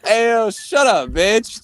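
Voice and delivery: high, drawn-out mock heckle; slow, sarcastic tipsy call-out; drunken sarcasm. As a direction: stretch it out like a drunk joker. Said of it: "sounded like a fucking drunk asshole".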